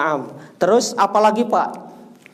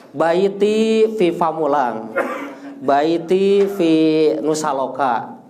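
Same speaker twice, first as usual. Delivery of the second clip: slow model answers; slower than usual. Shown as a demonstration. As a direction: give the answer slowly as a model. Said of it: "Rumahku di mana?".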